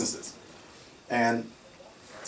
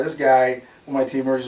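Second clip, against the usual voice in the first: ordinary delivery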